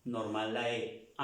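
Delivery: ordinary delivery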